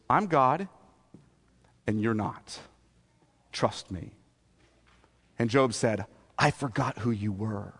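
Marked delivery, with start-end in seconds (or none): 0.1-4.1 low, hushed divine reassurance; softly spoken, final word; quiet authority. Acted. God's gentle final word to Job. 6.4-7.7 low, soft admission; hushed, penitent voice; humbled confession. Acted. Job humbly repents to God.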